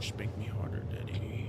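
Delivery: breathy joking whisper, voice dropped low; mock sultry whisper. Streamer jokes to chat in a mock sultry whisper. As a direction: whisper it like a cheeky come-on.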